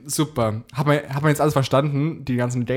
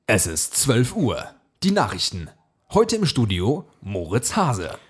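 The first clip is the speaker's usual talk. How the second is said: slow, serious newsreader delivery; news anchor voice; 3 semitones lower, slower than usual. Shown as a demonstration. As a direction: announce it like the noon news. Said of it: "in bester Nachrichtenmanier rüberzubringen".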